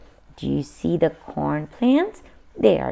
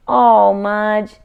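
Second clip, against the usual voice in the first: louder, dismayed sigh at the dog; exasperated; 6 dB louder than usual. A narrator's reading. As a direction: scold the dog fondly. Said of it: "Henry said".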